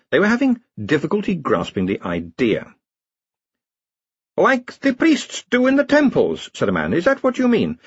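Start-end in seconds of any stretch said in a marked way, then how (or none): 4.4-6.5 high, slow questioning villager; a simple man, puzzled. A narrator's reading. Villager speaking to Rincewind. 6.9-7.8 a simple man, puzzled; villager voice. A narrator's reading. Villager asking.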